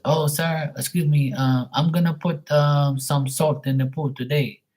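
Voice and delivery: high mock Filipino voice; Filipino accent. Pool guy proposing salt to his customer, mock Filipino accent. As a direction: imitate the Filipino pool guy, pitched well up. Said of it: "making fun of his voice and his accent".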